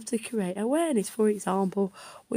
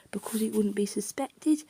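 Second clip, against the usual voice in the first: ordinary delivery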